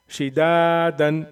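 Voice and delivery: "शिदादन" with दा held long and loud; long madd. Shown as a demonstration. Teacher demonstrating the one-alif stretch, "शिदादन" with long दा. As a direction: draw out the दा in "शिदादन" on a level note. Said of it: "खींच कर पढ़ेंगे".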